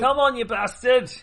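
loud, high excited yell; shouting at the dice. Real, not acted. Urging the dice roll.

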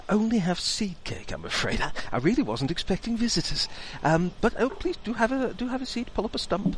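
high, apologetic fussing host; flustered host. Acted. Host apologising for only having seed cake, high fussy voice. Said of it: "NPC host apologising".

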